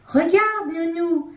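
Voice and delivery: put-on high, abrupt little-girl call; excited child calling nanny; sudden excited cry. A narrator's reading. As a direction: blurt it out eagerly to nanny. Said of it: "s'écrie brusquement Coco".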